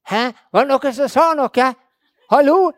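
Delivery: loud, high-pitched questioning; loud oblivious calling. Acted. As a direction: call out as if you heard nothing. Acts listeners who missed the whisper.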